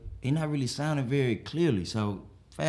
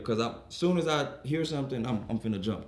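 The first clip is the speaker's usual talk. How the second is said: normal talking voice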